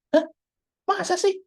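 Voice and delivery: sudden high-pitched "no way?"; surprised disbelief. Acted. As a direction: gasp out the doubt, then answer. Orang kaget dengar tabungan kosong. (kaget tidak percaya)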